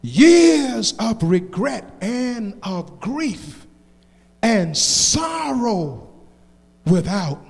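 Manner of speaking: slow, soft, low verse; solemn poem recitation. Acted. Preacher quoting poet on the price of sin, solemn recitation. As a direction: recite it slowly, pausing after each phrase.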